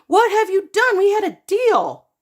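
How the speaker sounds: high, loud angry shout; furious. Acted. Mom shouting at son over broken deal, angry. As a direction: yell at him for breaking the deal.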